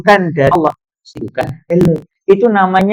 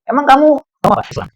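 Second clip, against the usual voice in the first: a touch higher, hurt and miffed; offended campus queen; 9 semitones higher, much faster than usual. Retold or imitated. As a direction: play the rejected beauty lightly.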